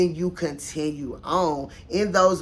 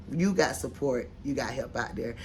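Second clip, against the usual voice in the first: soft reassurance; 5 semitones lower, 7 dB quieter than usual. Real, not acted. Reassuring a stressed viewer.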